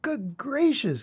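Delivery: high, slow gasp of surprise; a startled bird catching her breath. A narrator's reading. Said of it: "gasped Maisie".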